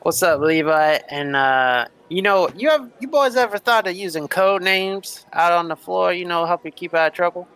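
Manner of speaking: Lightning Red voice. Acted. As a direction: coax them like a friendly hustler. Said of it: "And he says".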